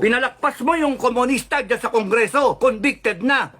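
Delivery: worked-up angry rant; angry tirade. Real, not acted. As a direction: berate them with indignant curses. Cursing out the president directly.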